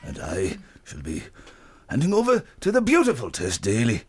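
low-pitched rival veteran host; Terry Wogan. Acted. Host insists on being Wogan, hands to Tess, low impression. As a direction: claim the part with a low, measured handover. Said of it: "that I'm Terry Wogan".

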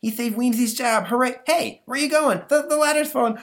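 very high, loud panic; panicked monster. Acted. Monster fleeing a cat off the ladder, high panic. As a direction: yelp as the ladder falls.

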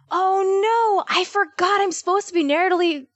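high, acted dismay; playful retold alarm; mock panic. Acts out her own panicked realization.

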